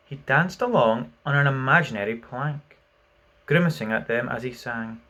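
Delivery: ordinary delivery, nothing marked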